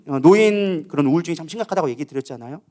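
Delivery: normal talking voice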